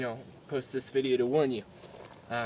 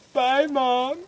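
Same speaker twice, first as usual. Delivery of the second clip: put-on high, loud little-kid farewell; mock anxious kid voice; nervous goodbye; 9 semitones higher, 5 dB louder than usual. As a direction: say bye like you're being led to your doom. Scared kid says goodbye to mom.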